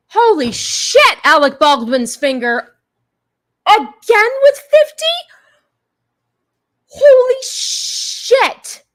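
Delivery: stunned, high-pitched disbelief; shocked. Real, not acted. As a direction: gasp it out, slowly, amazed. Huge donation arrives unexpectedly.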